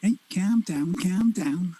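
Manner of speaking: high-pitched Scouse impression; Scouse accent. Joking about favourite English accents, high mock Scouse. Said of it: "The Scouse accent".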